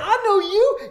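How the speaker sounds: very high, loud mock fan yell; hammed-up shout of recognition; imitating starstruck strangers. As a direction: squeal it like an overexcited stranger. Voicing excited passersby who recognize him.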